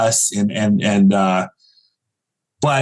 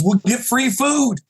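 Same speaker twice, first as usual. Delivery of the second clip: higher, slow, pleased dad voice; playful take on dad, drawn out; imitating dad; 11 semitones higher than usual. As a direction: sell the perk like it's a jackpot. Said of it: "He goes".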